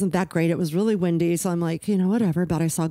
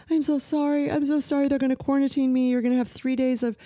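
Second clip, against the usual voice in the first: high, whiny tearful apology; imitated bawling; 8 semitones higher than usual. Crying daughter apologizing to mom about quarantine, mock bawling. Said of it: "she just starts bawling".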